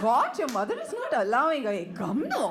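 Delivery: imitating Tamil-accented schoolgirl English. Schoolgirl urging a friend to come play, in a Tamil accent. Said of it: "I was talking like this".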